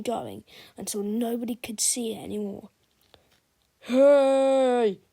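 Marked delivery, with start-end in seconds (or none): 3.8-5.0 loud, high call across the stands; shout. Acted. Fan in the stands shouts in outrage, loud high holler. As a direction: holler to get everyone's attention. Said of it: "shouted a fan in the stands".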